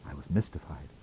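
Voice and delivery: usual speaking voice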